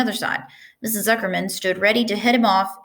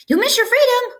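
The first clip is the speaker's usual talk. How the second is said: much higher goose honk; 5 dB louder than usual. A narrator's reading. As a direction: honk the order out. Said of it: "honked the goose".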